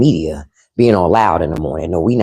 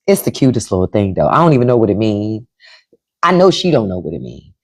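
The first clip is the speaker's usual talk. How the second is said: ordinary talk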